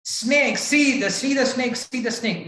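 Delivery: high, urgent, tumbling repeats; frightened cry. Shown as a demonstration. Showing the real panic on seeing a snake, high frightened blurt. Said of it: "No, you don't see".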